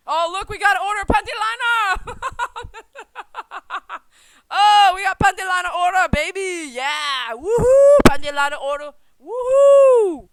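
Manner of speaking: loud, high, whooping with glee; excited cheering. Real, not acted. Cheering to viewers over the panty liner order, loud and whooping. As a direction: cheer it out like a win.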